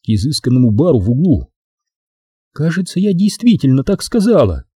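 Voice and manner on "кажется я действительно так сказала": high-pitched woman owning up; Maxine's sweet, high admission; confiding. A narrator's reading. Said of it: "Максин доверительно улыбнулась".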